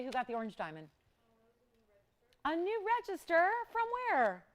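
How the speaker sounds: normal talking voice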